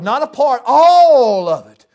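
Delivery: loud and slow, stretching all of it; preaching shout. Real, not acted. Preacher to the congregation, loud drawn-out cry. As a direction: drive it home at full force.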